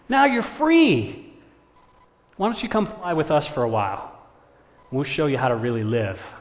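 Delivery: loud, goading crow voice; pushy tempter swooping up high; persuasive crow. Acted. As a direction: egg the listener on, loud and emphatic.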